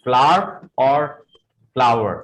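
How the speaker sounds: flower as "flaar", then "flaa-wer"; two pronunciations. Shown as a demonstration. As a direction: offer both versions, slowly. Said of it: "The right pronunciation for this word is".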